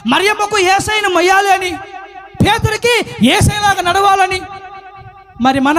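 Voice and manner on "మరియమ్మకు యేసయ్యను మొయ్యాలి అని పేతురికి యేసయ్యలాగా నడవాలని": high, ringing recap; impassioned preaching. Acted. Preacher holding up three examples to the flock, high and ringing. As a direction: proclaim each example high and emphatically.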